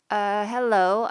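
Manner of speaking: uneasy hello; awkward hello. A narrator's reading. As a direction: greet him unsure of yourself. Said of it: "I said awkwardly".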